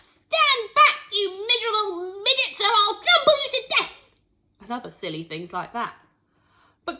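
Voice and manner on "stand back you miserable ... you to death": high, loud, threatening holler; rampaging grandma yelling at everyone; full shout. A narrator's reading. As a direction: shout threats while charging about. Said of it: "and shouting".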